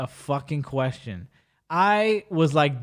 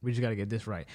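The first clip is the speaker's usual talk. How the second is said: muttered repeat to self; 7 semitones lower, 11 dB quieter, faster than usual. Real, not acted. Repeats under breath.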